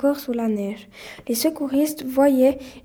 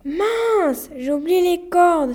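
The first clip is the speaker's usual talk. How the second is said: high, loud, drawn-out exclamation; dismayed cry; 5 semitones higher, 4 dB louder, much slower than usual. A narrator's reading. Gilles realises he forgot the ropes, crying out. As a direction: cry out as if just realising the blunder. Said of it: "s'écria Gilles".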